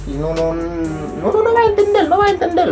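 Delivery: usual speaking voice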